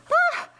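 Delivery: sharp, high startled scream "ah"; a loud, piercing yelp of shock; frightened cry. Acted. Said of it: "Martha screamed".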